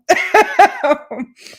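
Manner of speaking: high, loud laugh; real bright laughter. Real, not acted. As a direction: laugh at your own joke. Host laughs at own joke.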